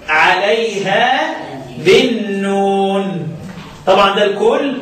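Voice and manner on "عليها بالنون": عليها بالنون drawn out slowly; slow stress on nun. Shown as a demonstration. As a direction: stretch بالنون to teach the stop. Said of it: "الوقف". (نطق بالنون ببطء للتعليم)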